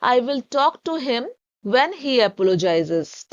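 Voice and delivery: each word drawn out very slowly for learners; slow dictation reading. Shown as a demonstration. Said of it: "तब हम English में बोलेंगे".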